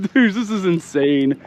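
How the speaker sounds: usual speaking voice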